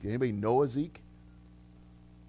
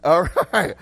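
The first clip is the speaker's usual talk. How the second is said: ordinary delivery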